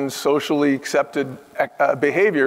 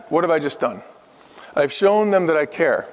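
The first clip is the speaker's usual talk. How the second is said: ordinary delivery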